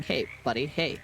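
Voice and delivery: ordinary talk, nothing marked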